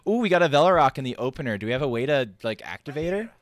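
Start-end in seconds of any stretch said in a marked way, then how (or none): 0.1-1.6 quick, thrilled gasp of delight; suddenly delighted by the draw; excited. Real, not acted.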